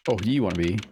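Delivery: normal talking voice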